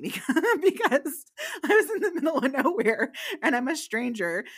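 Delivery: high, loud, laughing through the words; can barely explain it for laughing. Real, not acted. Storyteller recalling being a stranger in the middle of nowhere, laughing. Laughing at her own predicament.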